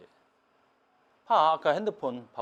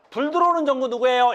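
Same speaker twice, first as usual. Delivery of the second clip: mock exam question; 7 semitones higher, 6 dB louder than usual. Teacher acting out a silly exam question, high mocking delivery. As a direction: pitch it up and call out the question. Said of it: "이런 거 묻는 거야".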